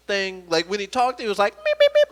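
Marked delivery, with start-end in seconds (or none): 1.5-2.1 tiny, very high, loud squeaks; Beaker. Retold or imitated. Comedian mimics kid's speech, squeaky Muppet voice. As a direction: squeak it out in rapid beeps. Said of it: "like Beaker from Muppets".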